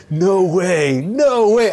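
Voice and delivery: mock freak-out, voice climbing; flipping out. Tim beside him flipping out over the car, high loud yelps. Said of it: "he's flipping out".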